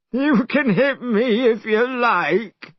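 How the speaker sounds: high, slow whiny grumble; complaining. A narrator's reading. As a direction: sulk at Monkey. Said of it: "complained Pigsy".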